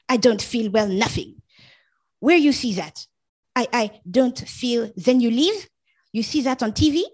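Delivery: high sneering parody of her words; mock her excuse with scorn. Husband mocks his sick wife's excuse, high sneering parody.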